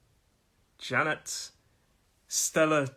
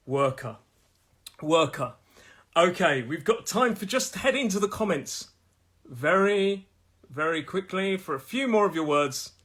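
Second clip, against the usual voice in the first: ordinary talk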